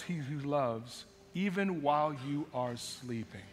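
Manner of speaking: low, soft, measured reading; scripture reading. Real, not acted. Pastor reading Psalm 127 to the church, low and reverent.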